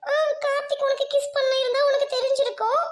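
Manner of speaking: high, wailing retort; crying outburst. Acted. Crying girl retorting to her dismissive friend, wailing. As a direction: snap back, then collapse into bawling. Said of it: "கத்திட்டு இருக்க".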